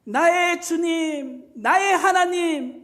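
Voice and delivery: tearful confession of faith; choked up. Acted. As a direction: draw it out as if on the edge of tears. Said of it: "울먹울먹거리면서 말을 했다는 거예요".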